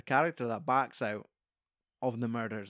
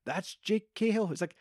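higher excited fan gush; young hippie recognizing a TV star, excited; starstruck fan; 6 semitones higher than usual. Retold or imitated. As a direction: gush over a childhood hero. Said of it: "Rex is like".

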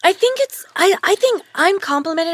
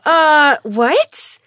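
loud confused what; baffled; 8 dB louder than usual. Retold or imitated. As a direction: act stunned by the question. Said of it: "And I go".

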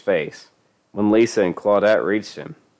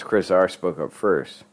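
ordinary delivery, nothing marked

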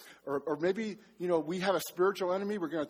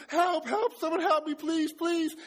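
high, loud desperate begging for help; panicked pleading; 10 semitones higher, 3 dB louder than usual. Retold or imitated. Pastor retelling drowning scare to congregation, frantic high pleas. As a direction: beg for rescue in a panic. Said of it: "And I'm, like, panicking".

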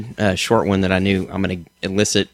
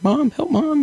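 mock panic; slower than usual. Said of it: "you're just like".

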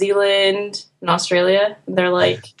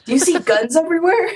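higher-pitched, wide-eyed mock curiosity; imitated eager questioning; 6 semitones higher than usual. Australians quizzing an American about guns, imitated eager curiosity. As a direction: pepper them with rising, incredulous questions.

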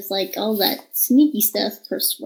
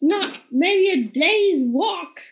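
high, slow, drawn-out gushing; play-acted awe, stretching the words; mock amazement; 8 semitones higher, slower than usual. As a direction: tempt them with hammed-up wonder. Luring ogres with cow tale.

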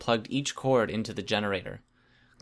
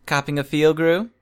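higher, louder teasing question; mocking; 5 semitones higher, 6 dB louder than usual. A narrator's reading. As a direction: needle him with a rising, playful jab. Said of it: "Imp mocked".

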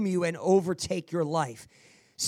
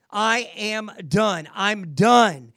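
slow, emphatic I'M DONE, louder on the last; yelled declaration; 4 dB louder, much slower than usual. Shown as a demonstration. Coach showing officer how to surrender, yelling it out. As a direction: declare it out loud, each word drawn out. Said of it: "yell out loud, I'm done".

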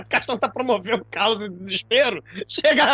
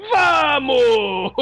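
loud barked order; shouted command; 5 semitones higher, 4 dB louder than usual. Retold or imitated. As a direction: shout it like a horror villain. Zé do Caixão orders villagers.

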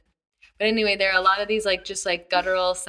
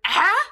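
high squeaky questioning ah; cartoon grunt; 10 semitones higher, 6 dB louder than usual. Shown as a demonstration. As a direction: yelp a rising ah like a cartoon. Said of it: "this is another one that I picked up too".

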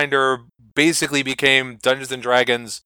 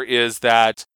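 usual speaking voice

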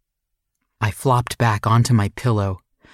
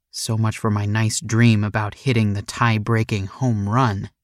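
ordinary delivery, nothing marked

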